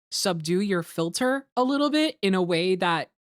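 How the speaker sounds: normal talking voice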